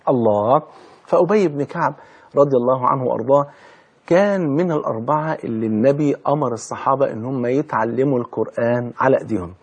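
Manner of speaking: usual speaking voice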